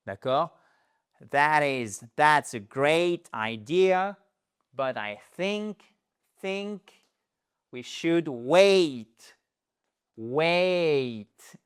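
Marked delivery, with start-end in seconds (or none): 1.3-11.6 slow polite refusal. Shown as a demonstration. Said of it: "C'est exactement la même chose".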